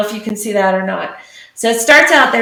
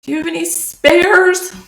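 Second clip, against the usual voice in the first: toothachy dinosaur; 9 semitones higher, 4 dB louder than usual. Acted. Dinosaur begging the traveling dentist for help, high and whiny. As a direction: plead in a high-pitched creature voice.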